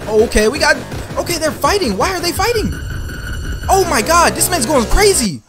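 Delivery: high, loud hyped reaction; excited. Real, not acted. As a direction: shout it out, totally hyped. Hyped reacting to fight scene.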